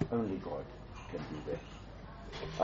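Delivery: hushed, very slow near-whisper; a quiet, drawn-out private thought; awed thought. Retold or imitated. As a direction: breathe it out slowly in wonder. Inner thought in awe.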